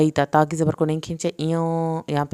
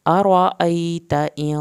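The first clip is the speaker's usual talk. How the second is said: "ارأیت" slow and louder, short ta; slow recitation; 6 dB louder, much slower than usual. Shown as a demonstration. Teacher to students: recited verse, slow. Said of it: "تا کی زبر کو نہ کھینچیں".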